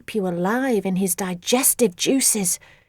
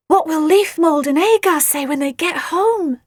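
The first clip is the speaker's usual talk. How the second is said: gasping; 6 semitones higher, 5 dB louder than usual. Acted. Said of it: "she gasped".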